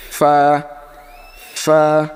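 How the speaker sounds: ফা with a long airy f; lip-to-teeth fa. Shown as a demonstration. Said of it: "নিচের ঠোঁট". (নিচের ঠোঁট দাঁতে লাগিয়ে ফা)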